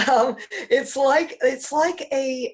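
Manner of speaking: hoarse morning voice, slow with a laugh; croaky just-woke-up voice, laughing it off. Real, not acted. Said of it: "Still got my morning voice".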